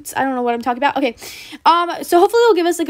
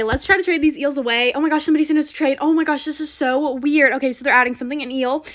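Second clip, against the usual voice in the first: ordinary delivery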